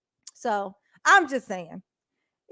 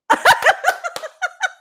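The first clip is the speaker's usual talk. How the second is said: high laugh; 7 dB louder than usual. Real, not acted. Laughing at the viewer.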